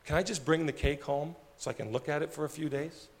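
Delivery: barely able to say it; quiet Agnes. Retold or imitated. Agnes telling her friends she never had a party, quiet and moved. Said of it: "She goes".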